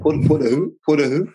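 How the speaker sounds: high mocking imitation; mocking Meg. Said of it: "Just saying".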